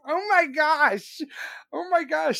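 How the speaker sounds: high, squealing laugh-talk; can barely get the words out for laughing. Real, not acted. Cracking up at the chat's joke, squealing laugh.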